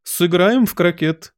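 high, drawn-out call; imperious yell. A narrator's reading. Queen inviting Alice to croquet, yelling. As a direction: call out a royal invitation slowly. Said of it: "завопила королева".

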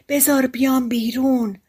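lightly voiced captive begging to get out; trapped creature pleading. A narrator's reading. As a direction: call up from confinement.

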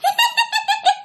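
very high, rapid ghostly laugh; creepy cackle. Retold or imitated. Ghost still laughing despite being cursed, creepy high cackle. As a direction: keep cackling eerily, high and fast. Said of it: "cái giọng cười thấy ghê lắm".

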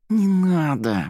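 slow, high, drawn-out plea; pleading. A narrator's reading. Volya begs his pretend girlfriend to hold back, pleading. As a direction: beg her to drop it. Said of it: "взмолился Воля".

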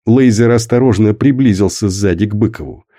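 plain reading voice

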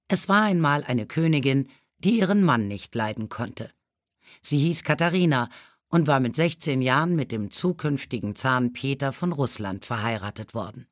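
plain reading voice

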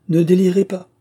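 ordinary delivery

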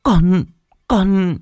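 high, halting, tearful questions; confused sobbing. Acted. Sobbing girl asking her mother what is happening. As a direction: ask through sobs, lost. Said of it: "hỏi câu được câu trăng".

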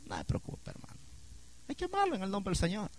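whispered, gentle pastoral reply; soft reassuring whisper. Retold or imitated. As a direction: whisper it like calming a gossiping member. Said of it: "Y yo le digo".

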